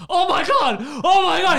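loud, high "oh my God" shout; mock freakout shout. Retelling a friend's panic, loud high mock shout. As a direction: ham up a panicked friend yelling. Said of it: "He started flipping out".

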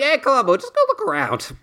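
breezy Dirk. Retold or imitated. Dirk waving Columbo into the house, high mock-breezy voice.

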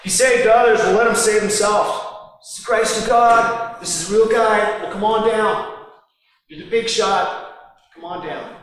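mock him from below the cross; sneering. Preacher voicing rulers jeering at Jesus, sneering loudly. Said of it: "This is done in a sneering way".

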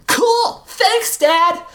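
put-on high, loud delighted kid; excited little girl. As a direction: squeal with delight like a child. Excited daughter in story.

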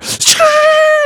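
sudden high, loud shriek of laughter. Real, not acted. Recalling spinning out again, a shrieking laugh. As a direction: burst out in a squeal of laughter.

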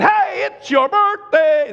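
high, loud, slow sing-song shout; cheery greeting. Retold or imitated. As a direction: burst in celebrating her birthday. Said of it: "That's how I walked in there".

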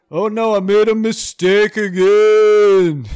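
comic acting out his own blunder; mock dopey whine. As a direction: play it as a goofy self-pity. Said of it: "I'd be like".

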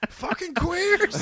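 high, slow, loud mock yell; imitated slur yell. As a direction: bark it like the angry neighbor. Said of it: "You fucking queer".

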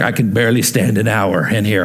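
low grumbling mock complaint from a bored churchgoer; lightly mocking a restless congregant; whiny churchgoer. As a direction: grumble like someone stuck in a long service. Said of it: "Some of you are like".

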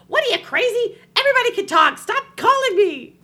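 high, loud exasperated yelling; fed up. Acted. Woman who's had enough yelling at the caller, shrill mock rage. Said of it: "she's had enough".

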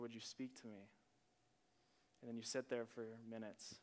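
soft, low, with long restful pauses; quiet prayer. Acted. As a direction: settle into stillness and pray gently. Models silent, restful prayer.

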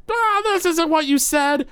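very high, drawn-out complaining holler; Gorbo Borgo's loud voice. Acted. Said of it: "Gorbo Borgo's loud voice".

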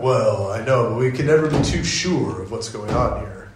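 mock Pharisees. Pharisees demanding proof from Jesus, smug and fast. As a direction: play the skeptics as smug and offhand. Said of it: "They're like".

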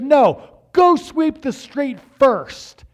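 slow, high, loud command; dad insisting the son sweep the street, loud and slow; loud order. Retold or imitated. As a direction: lay down the law, slowly.

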